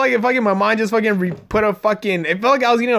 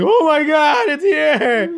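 much higher, louder happy cry; thrilled squeal; 11 semitones higher, 4 dB louder, much slower than usual. Retold or imitated. Family spotting the pizza arriving, high excited cry. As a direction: burst out like kids seeing the pizza. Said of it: "Everybody was like".

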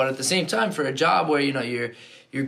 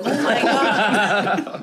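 slow mock tourist gasp; 6 semitones higher, much slower than usual. Mocking tourists taking photos.

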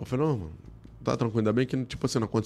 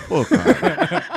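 laughing; 14 semitones higher, 5 dB louder, much slower than usual. Real, not acted. Recalling a security guard's question.

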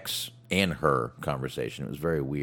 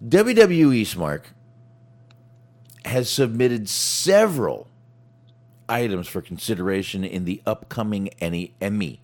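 announcer build-up; 3 semitones higher, 6 dB louder than usual. Pretend. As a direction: announce it grandly, stretching each phrase. Mock grand announcement to co-host.

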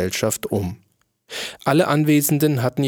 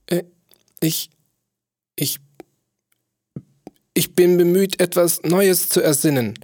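higher, stammering, flustered dwarf; distracted dwarf; 6 semitones higher than usual. A narrator's reading. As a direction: stumble over the words, drunk and scattered. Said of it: "antwortete der Zwerg zerstreut".

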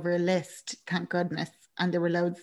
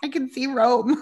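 higher-pitched re-enacted excitement; mock-excited announcement, voice raised in pitch; excited texting; 6 semitones higher, 3 dB louder than usual. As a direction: gush it to a friend, pitched up. Recalls excitedly texting her friend.

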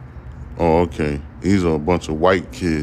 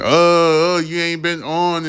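mocking the haters; 10 semitones higher than usual. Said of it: "I said in the beginning of this segment".

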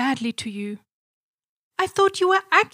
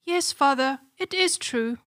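sad. Acted. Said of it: "said the princess sadly".

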